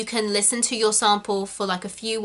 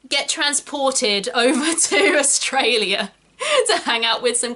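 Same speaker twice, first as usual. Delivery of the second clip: laughing; 4 semitones higher than usual. Real, not acted. Laughing through her own story.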